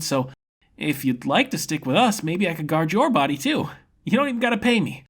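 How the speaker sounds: a carefree fighter enjoying the duel; grinning swordsman impressed by his foe; cheerful young fighter. Acted. Ren's kind speech.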